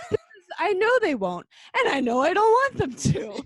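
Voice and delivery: high, loud, half-laughing declaration; Dennis's fierce denial, hammed up; mock outburst. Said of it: "and then Dennis goes".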